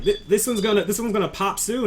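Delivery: high, insistent character voice; ma'am's protest. Acted. Ma'am refuses to trade the loyalist, protesting. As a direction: pitch it right up, insisting.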